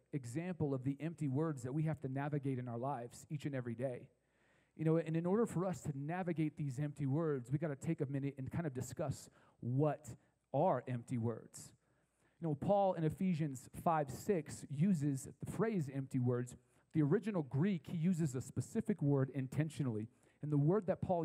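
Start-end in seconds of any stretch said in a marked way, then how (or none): none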